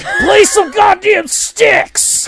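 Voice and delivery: high, full-voiced drawn-out shout; yelled heckle. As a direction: yell it like a rowdy concert heckler. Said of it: "only yelled out a couple".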